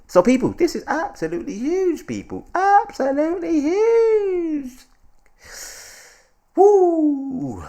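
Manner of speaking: high, stretched-out excited cry, ending in a whoop; hyped exclamation. Real, not acted. Host thrilled by big news for viewers, hyped exclamation. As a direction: sing out the excitement at full pitch.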